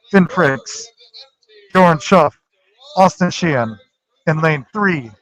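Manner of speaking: loud swim meet announcer. Acted. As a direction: call out the lineup to the pool. Announces relay lineup loudly.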